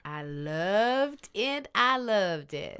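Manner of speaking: slow, drawn-out Southern gushing; emphatic Southern accent, words held long. Real, not acted. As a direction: let the Southern accent out, stretching loved. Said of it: "The Southern accent's coming out".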